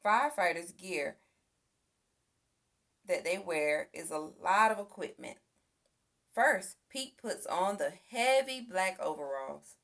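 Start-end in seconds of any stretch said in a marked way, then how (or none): none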